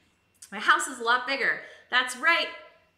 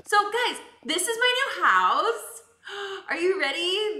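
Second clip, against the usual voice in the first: hyped house-tour host; 10 semitones higher, 6 dB louder than usual. Pretend. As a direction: show off the new house like a Cribs host. House tour like Cribs edition.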